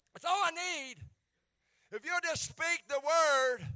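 high, loud, building pleading; pleading cry. Acted. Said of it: "asking him".

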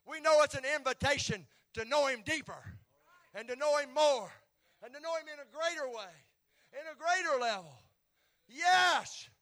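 loud, high preacher's whoop; rising sermon climax, shouted; preaching at full force. Acted. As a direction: drive it home like a revival preacher.

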